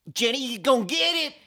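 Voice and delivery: high, loud backwoods holler; out-of-nowhere holler. Shown as a demonstration. Shouting at home from the other room, high backwoods holler. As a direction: yell it like a hillbilly catchphrase. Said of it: "Marcus will hear out of, like, the, like, the other room".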